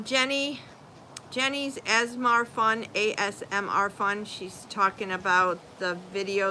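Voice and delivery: ordinary talk, nothing marked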